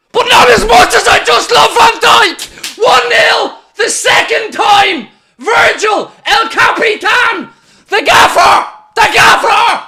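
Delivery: ecstatic screaming, high and loud; goal celebration shout. Real, not acted. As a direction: scream it with pure joy.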